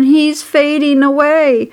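high, drawn-out cry of alarm; mild scream. A narrator's reading. Girl alarmed that her pig is fainting, mild scream. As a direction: call out in alarm, short of a full scream. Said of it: "screamed Fern".

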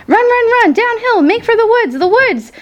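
very high, urgent goose holler. A narrator's reading. Goose urges the runaway pig to flee, hollering high. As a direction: urge him on in a high calling voice. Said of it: "she too started hollering".

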